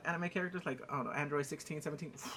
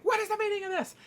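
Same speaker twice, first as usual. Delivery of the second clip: high, loud mock outrage; indignant demand; 5 dB louder than usual. Grandparents arriving at the fight, indignant cry.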